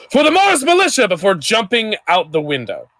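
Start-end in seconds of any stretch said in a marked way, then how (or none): 0.1-1.1 battle cry, high and loud; shout. A narrator's reading. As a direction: yell it as a rallying cry.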